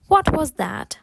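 natural-speed, connected question. Shown as a demonstration. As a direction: say it in one quick run. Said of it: "потім спробувати швидше".

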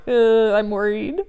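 hammed-up worry; mock worried. Shown as a demonstration. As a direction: act fretful for the kids. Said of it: "I'm like".

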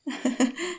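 soft high little laugh; shy giggle. Acted. Happy at his affection, soft shy giggle. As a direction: giggle shyly.

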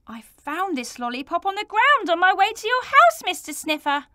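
building protest. Acted. Bert protesting his innocence to Mister Sniffer. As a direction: build up to the last these.

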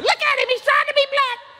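very high, squeaky falsetto woman; Miss S. Retold or imitated. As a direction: shriek it in mock-scandalized falsetto. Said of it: "Miss S said".

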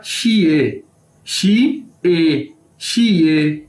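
"शी ए" drawn out as shee-ay; syllable-by-syllable demo. Shown as a demonstration. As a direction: say "शी ए" slowly, each part held. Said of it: "ये क्या बन जाएगा".

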